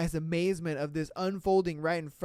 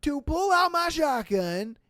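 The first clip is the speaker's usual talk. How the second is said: mock tough trucker; 8 semitones higher, 6 dB louder than usual. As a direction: ham up the trucker's threat. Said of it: "and says".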